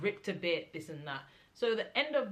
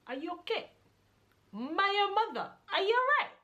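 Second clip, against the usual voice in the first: much higher and louder, rising in disbelief; indignant outburst; 13 semitones higher, 6 dB louder than usual. Retold or imitated. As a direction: build from a sharp question to an outraged shout. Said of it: "giving my mum attitude".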